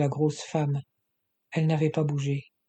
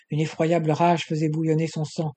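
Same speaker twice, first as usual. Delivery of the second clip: usual speaking voice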